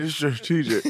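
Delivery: laughing while repeating it. Retold or imitated. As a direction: chuckle through the fancy word. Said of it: "you like how I used it".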